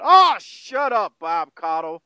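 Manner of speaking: high, drawn-out exasperated mock yell; imitating Dutch's yell. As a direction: groan it out loudly, then drop on the name. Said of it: "And Dutch yells out".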